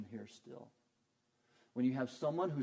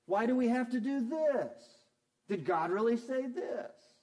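high, loud whiny griping; mock complaining; 10 semitones higher, 8 dB louder than usual. Preacher mimicking false teachers' constant complaints, high loud whine. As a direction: needle away like a constant complainer, pitched up. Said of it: "They're constantly complaining".

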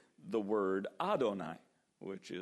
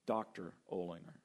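softer, lower, cool and formal; stiff formal correction; 6 semitones lower, 4 dB quieter than usual. Pretend. Pastor coldly insists on his title to a friendly congregant. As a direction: hold them at arm's length with the title. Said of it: "I put up a wall".

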